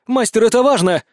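high, loud urgent call; shouting. Acted. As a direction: shout it over the battle. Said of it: "кричит Силати".

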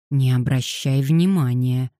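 ordinary delivery

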